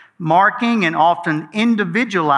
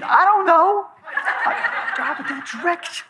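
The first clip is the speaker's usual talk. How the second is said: high, light imitation of the wife; imitating his wife; 13 semitones higher than usual. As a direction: mimic the wife, breezy and unbothered. Wife voiced, unbothered answer.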